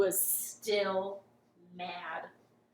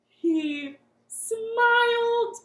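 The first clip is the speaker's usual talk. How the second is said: awed gasp; 10 semitones higher, 10 dB louder, much slower than usual. A narrator's reading. Storyteller wonder at climax.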